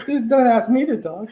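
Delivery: says it through a laugh, pitch up. Real, not acted. Guest laughing to the minister, joking through laughter. Joking after finishing her talk.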